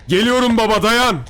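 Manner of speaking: high, loud urgent reassurance; shouting. A narrator's reading. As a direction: yell down the corridor that help is coming. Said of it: "beklemesi için bağırdım". (telaşla, yüksek sesle bağırarak)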